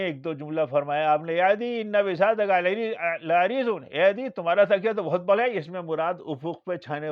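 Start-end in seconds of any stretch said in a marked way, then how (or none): 1.3-5.6 حیرت بھرا خطاب. Retold or imitated. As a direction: call out in amused amazement, voice raised. Acting out the Prophet's exclamation to Adi. (بلند آواز میں تعجب سے پکارنا)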